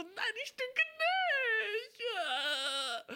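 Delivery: high, drawn-out crying wail; hammed-up angry sobbing; bawling child tantrum. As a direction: wail it like a furious child in tears. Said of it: "du fängst so krass an zu weinen".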